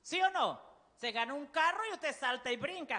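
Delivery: ordinary delivery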